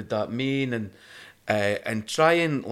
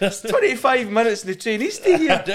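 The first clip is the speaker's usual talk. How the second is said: high, loud, laughing protest; laughing indignation; 11 semitones higher, 6 dB louder than usual. Retold or imitated. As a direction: protest through laughter, outraged. Indignant reply to Glaswegian, laughing.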